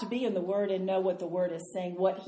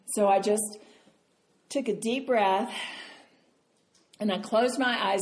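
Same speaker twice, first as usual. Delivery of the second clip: usual speaking voice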